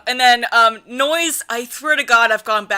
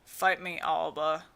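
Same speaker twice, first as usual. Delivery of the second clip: Noise; 5 semitones lower, 7 dB quieter than usual. Acted. Showing co-reader Noise's voice, low surly mumble. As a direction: drop it low and mumble lazily. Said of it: "not that much mumbly, but".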